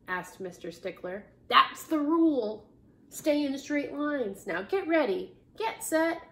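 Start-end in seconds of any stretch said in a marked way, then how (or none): 1.5-6.2 a touch of the commanding principal; rally them with mild authority; mildly bossy orders. A narrator's reading. Principal counts down to pulling.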